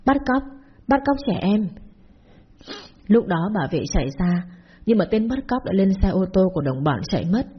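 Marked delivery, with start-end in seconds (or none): 0.0-1.7 high alarmed calling out, pitched up; shouting for help. Acted. Neighbour calls out to the guards about the kidnapping, a high alarmed shout. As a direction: sound the alarm across the courtyard. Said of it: "hét lớn".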